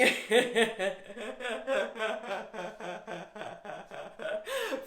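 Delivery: long hearty falling laugh; laughing on purpose. Shown as a demonstration. A laughter yoga teacher leading the group, hearty laugh. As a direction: laugh all the way down, full out. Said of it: "laugh on the way down".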